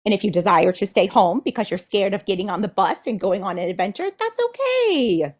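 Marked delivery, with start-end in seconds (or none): 4.2-5.3 gentle reassurance. Acted. Reassuring a scared child.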